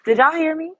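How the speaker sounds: high, loud thrilled questions; thrilled they could hear; excited disbelief. Real, not acted. Streamer asking chat if they heard her from afar, high thrilled calls.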